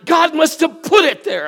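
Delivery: put-on loud, high, laughing little girl's protest; imitating the daughter's excuse with a laugh; animated daughter. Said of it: "she's very animated".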